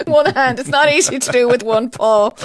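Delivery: laughing. Real, not acted. Fumbling with mouth tape, laughing.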